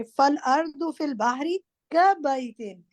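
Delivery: careful model reading ending on كَبَيْتٍ, each sound clear; teacher modelling Quranic Arabic, كَبَيْتٍ with a clear ay and final tin; model recitation. Shown as a demonstration. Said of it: "اب آپ سب غور سے سنیں".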